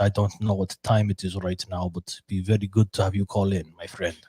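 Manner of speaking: playful put-on Russian accent. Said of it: "That's my Russian accent".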